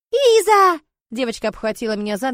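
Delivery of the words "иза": put-on very high, loud little-girl scream; child's wail. Little girl clinging to departing Iza, shrill childish wail. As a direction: cry out like a desperate small child. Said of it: "раздался детский вопль".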